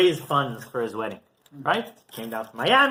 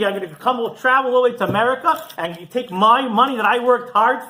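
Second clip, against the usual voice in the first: higher, louder mock tirade at a beggar; retold angry yelling; 6 semitones higher, 4 dB louder than usual. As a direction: rich man berating, half acted, rising in pitch. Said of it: "He starts yelling at him".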